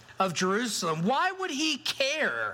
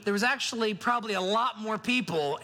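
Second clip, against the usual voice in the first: usual speaking voice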